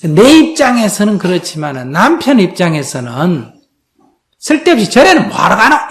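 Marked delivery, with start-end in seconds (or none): 4.4-5.9 higher, louder scornful grumble; husband's grumbling. Retold or imitated. Husband dismissing his wife's temple visits, scornful. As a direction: sneer at the pointless temple trips. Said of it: "이런 생각이 든다".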